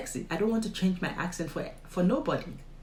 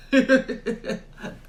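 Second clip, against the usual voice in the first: loud high amused laugh; real, helpless laugh at someone's indiscretion; laughter; 6 semitones higher, 7 dB louder than usual. Real, not acted. Amused that he couldn't shut up.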